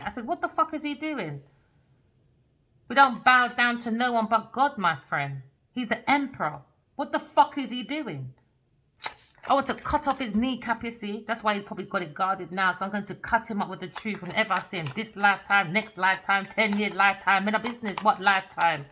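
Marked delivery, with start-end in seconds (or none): 0.2-1.4 high-pitched exasperated outburst; pissed off. Retold or imitated. Reader recalling reaction to that picture, pissed off. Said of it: "I was so pissed off". 2.9-18.9 loud, heated tirade; angry rant. Real, not acted. Reader vowing to cut him up with truth, angry rant.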